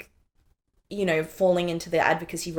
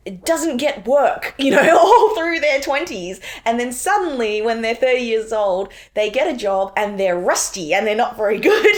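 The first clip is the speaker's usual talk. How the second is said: laughing retelling; 6 semitones higher, 7 dB louder than usual. Retold or imitated. Mocking the paper's hypothetical.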